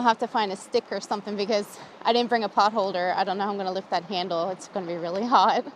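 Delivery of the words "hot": ordinary delivery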